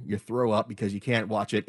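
ordinary talk, nothing marked

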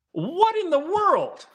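high, slow, incredulous cry; stunned disbelief. Real, not acted. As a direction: let the disbelief crack up into a squeak. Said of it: "I'm upset".

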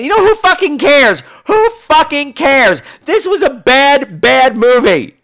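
exasperated rant. Real, not acted. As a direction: hammer each word in disgust. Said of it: "That's what I gotta say".

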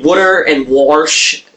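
slow, drawn "Water and warsh" with a Baltimore r; slow Baltimore words. Shown as a demonstration. Listing hated Baltimore words to the co-host, slowly. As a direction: drag out "warsh" with the intrusive r. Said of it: "Warsh gets under my skin".

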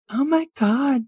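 put-on very high, girly nervous awe; high-pitched flustered onlooker; awed valley girl. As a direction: ask timidly in a high girlish voice. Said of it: "and you're like".

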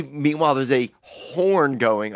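ordinary delivery

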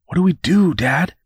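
high, helpless appeal; distressed plea. A narrator's reading. Tearful son asking his father, distressed plea. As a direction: plead it high, lost for what to do.

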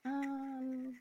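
a hint of a shy child; small kid suggesting dancing, softly; child's voice. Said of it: "my kid looks at me and goes".

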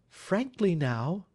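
high, earnest woman pleading for truth; wife begging Holmes for an honest answer; anxious wife. Acted. Wife insists.

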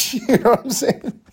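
words squeezed high through a laugh; real laughing. Real, not acted. Podcaster amused by meeting the same guy, laughing. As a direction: crack up mid-phrase, pitch shooting up. Cracks up at own remark.

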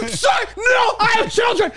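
hammed-up desperate plea; begging. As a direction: plead for your life. Driver begging for life.

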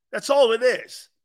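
usual speaking voice

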